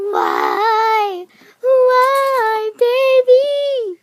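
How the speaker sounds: high, loud wailing plea; dramatic wailing. Acted. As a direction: wail it like a heartbroken lover. Jilted kid pleads.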